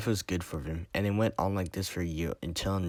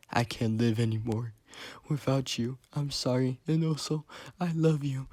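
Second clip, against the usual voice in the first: high, distraught voice, climbing higher; tearful despair; 8 semitones higher than usual. A narrator's reading. Gio's last words to dead Enrique, tearful despair. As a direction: say it at a high pitch, as if crying. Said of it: "He cries louder and louder".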